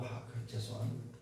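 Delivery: very soft, low, backing-down apology; retold apology, voice sinking away; subdued apology. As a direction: give in quietly and apologise. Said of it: "수그러지더라고".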